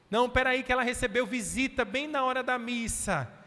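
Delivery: excuse-maker voice. Retold or imitated.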